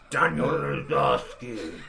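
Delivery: put-on slow, labored old actor's line reading; dying Rutger Hauer. Mocking an actor's last performance in a game, dying and slow. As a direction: drag the name out like a dying performer. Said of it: "a dying Rutger Hauer giving his last performance".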